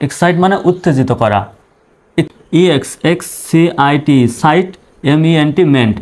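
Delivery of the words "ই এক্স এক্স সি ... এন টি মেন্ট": "ই এক্স এক্স সি আই টি, সাইট" spelled as separate letters, then the syllable joined. Shown as a demonstration. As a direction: break the word into letters and syllables. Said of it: "এভাবে ভেঙে ভেঙে উচ্চারণ করবেন". (অক্ষর ভেঙে ভেঙে বানান করে উচ্চারণ)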